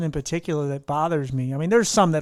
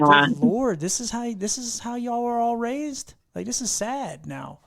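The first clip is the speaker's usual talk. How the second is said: mock dismay; 7 semitones higher, 3 dB quieter, slower than usual. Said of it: "'Cause it's like".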